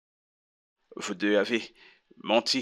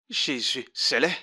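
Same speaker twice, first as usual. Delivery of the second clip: disgusted; 4 semitones higher than usual. Acted. Shocked pastor reacts to confession.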